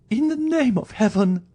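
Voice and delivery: high, wailing entreaty; frantic high-pitched begging; desperate plea. Acted. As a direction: implore in a very high woman's voice. Wife begs for her life.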